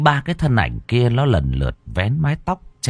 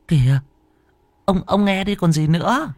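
high-pitched, stammered urging; anxious wife; 10 semitones higher than usual. Acted. Wife urges her husband to answer the phone, high and anxious. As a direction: urge nervously in a much higher voice.

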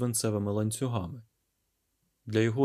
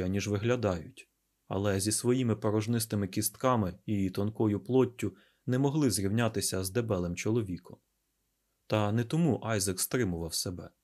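plain reading voice

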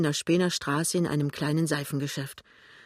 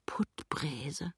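very soft whisper to herself; musing, whispered inner voice; whispered thought; 10 dB quieter than usual. A narrator's reading. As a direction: savour the name in a whisper. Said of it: "denkt sie".

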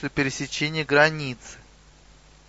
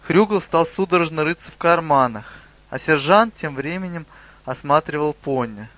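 usual speaking voice

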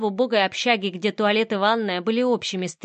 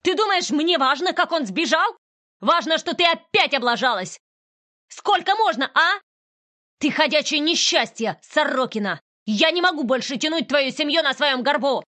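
higher, louder furious rant; shaking with rage; 7 semitones higher, 3 dB louder than usual. Acted. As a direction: spit out every accusation in fury. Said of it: "трясясь от ярости". (трясясь от ярости)

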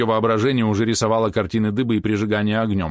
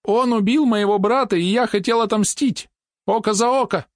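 clear-voiced boy; 13 semitones higher than usual. A narrator's reading. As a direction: state it clearly, head raised. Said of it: "чётко сказал".

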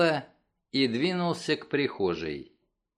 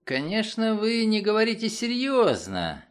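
higher-pitched, worried protest; agitated; 7 semitones higher than usual. A narrator's reading. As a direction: plead in alarm, voice raised in pitch. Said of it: "взволновался Карл Хейт". (взволнованно, высоким голосом)

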